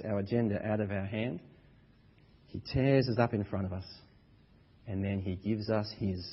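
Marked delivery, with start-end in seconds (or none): none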